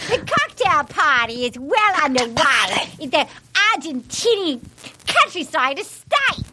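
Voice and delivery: over-the-top New Zealand accent attempt; Robin Leach. Shown as a demonstration. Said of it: "like if Robin Leach was on crack".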